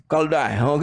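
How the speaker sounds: ordinary talk, nothing marked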